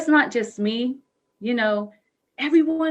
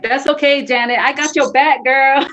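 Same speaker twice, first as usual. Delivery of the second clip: loud, higher-pitched shout-out; calling out support; 4 semitones higher, 10 dB louder than usual. Real, not acted. Stand-in guest calling out to Janet, loud and supportive. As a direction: belt out your support to a friend.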